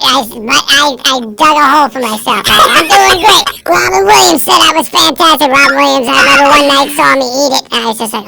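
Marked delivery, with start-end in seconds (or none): 2.8-7.6 higher and louder, pumped boast; overexcited lie about a famous fan; hyped-up brag. Retold or imitated. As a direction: sell it big to the boys. Bragging about Robin Williams.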